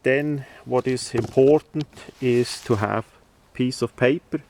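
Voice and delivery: normal talking voice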